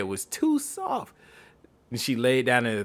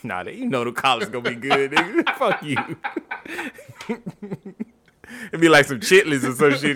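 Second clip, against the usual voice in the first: usual speaking voice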